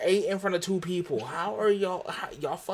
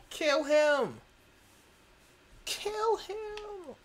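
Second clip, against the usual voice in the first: shouting; 12 semitones higher, much slower than usual. Real, not acted. Urging others to kill player.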